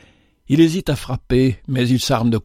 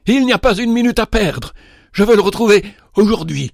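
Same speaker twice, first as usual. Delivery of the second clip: much higher and louder, fast and pressing; desperate outburst; 9 semitones higher, 4 dB louder than usual. A narrator's reading. Father overruling the old servant's caution, loud and desperate. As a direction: burst out, he cannot wait a minute.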